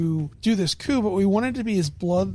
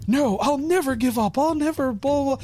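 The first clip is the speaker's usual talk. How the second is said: stubborn king; 7 semitones higher than usual. Retold or imitated. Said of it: "the king was like".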